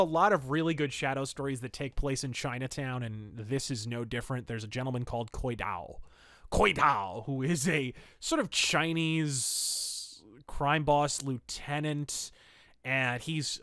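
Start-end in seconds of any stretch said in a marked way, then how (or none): none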